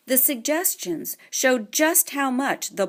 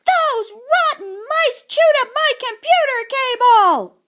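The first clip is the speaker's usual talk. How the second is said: much higher, louder slow angry shout; furious yell; 7 dB louder than usual. A narrator's reading. Boy screaming at his uncle about the mice, furious. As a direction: rage at the rotten mice. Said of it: "he hollered".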